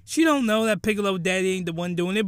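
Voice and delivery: usual speaking voice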